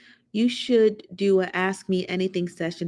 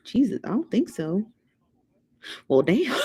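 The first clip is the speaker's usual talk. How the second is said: usual speaking voice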